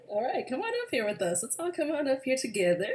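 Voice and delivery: ordinary talk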